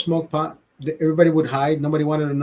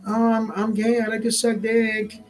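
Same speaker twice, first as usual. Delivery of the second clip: mocking flamboyant voice; 6 semitones higher than usual. Mocking pride marchers in a high put-on voice. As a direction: sneer it out in a high put-on lilt. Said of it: "these pride people wanna go around".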